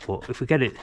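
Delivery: ordinary delivery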